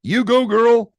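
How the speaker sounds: high, loud cheer; cheering grandparents. Acted. Grandparents cheering Poppy on, high and loud. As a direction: whoop it up for her. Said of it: "said Poppy's grandparents".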